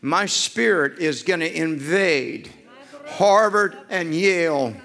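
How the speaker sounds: loud, high preaching at full force; shouted prophecy. Real, not acted. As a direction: proclaim it with rising fire.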